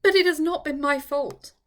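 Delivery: loud, tearful protest; almost crying. A narrator's reading. Eleanor insisting to Charlotte, near tears. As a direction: insist it wasn't her fault, close to tears. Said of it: "almost crying again".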